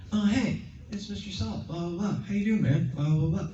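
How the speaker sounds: light up as if he's really there; bright excited greeting. Shown as a demonstration. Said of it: "So you have to be like".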